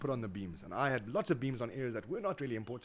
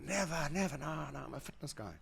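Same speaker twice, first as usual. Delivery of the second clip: lightly voiced indignant refusal, higher; a hint of a cocky fitness guy saying no; younger self refusing; 6 semitones higher than usual. Young him brushing off drugs, lightly voiced higher refusal.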